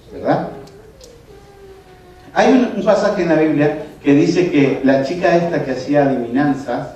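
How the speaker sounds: usual speaking voice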